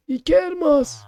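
lightly voiced old shopkeeper.

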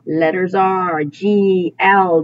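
normal talking voice

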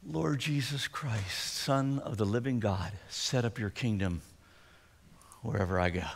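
very soft and slow, breathed out; slow breath prayer. Shown as a demonstration. Pastor modelling a breath prayer for the church, soft and slow. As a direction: let each word ride the exhale. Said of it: "favorite breath prayers is a little longer".

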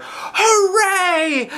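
high, loud cheer; yelling hooray with joy; excited yell. A narrator's reading. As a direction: shout it like a thrilled kid. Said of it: "I yelled".

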